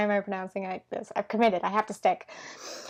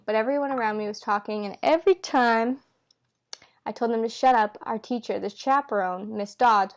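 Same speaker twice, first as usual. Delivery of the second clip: ordinary delivery, nothing marked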